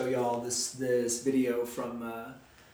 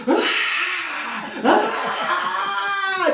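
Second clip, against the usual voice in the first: high shrieking ah ah, much higher and louder; mock terrified screaming; 6 dB louder than usual. Teacher acts the man seeing an angel: terrified scream. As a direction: shriek in panic like a frightened man. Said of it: "just screaming".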